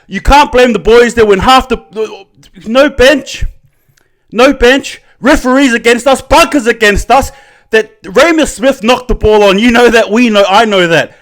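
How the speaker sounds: loud, high-pitched fan outrage; outraged rant. Real, not acted. Fan railing against referees and bunkers, outraged. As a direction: punch out each grievance, pitched well up. Ranting at referees, then apologises.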